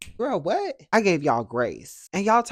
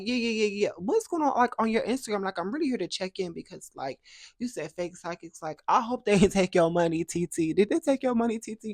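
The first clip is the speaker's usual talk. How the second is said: fake concern; 7 semitones higher than usual. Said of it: "I was like".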